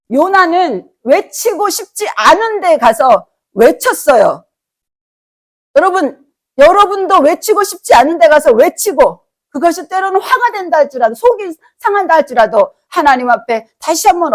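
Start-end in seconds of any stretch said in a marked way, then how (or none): 0.1-4.5 slow, weighted sermon line; emphatic preaching. Acted. 5.8-13.3 louder, pleading to the congregation; rising appeal. Acted.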